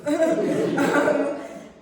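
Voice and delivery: big burst of real laughter; loud laugh. Real, not acted. Laughing at long March break.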